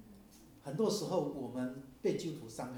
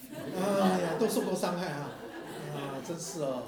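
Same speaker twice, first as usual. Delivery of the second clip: laughing; 2 semitones higher, 6 dB louder, slower than usual. Real, not acted. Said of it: "laughing at congregation".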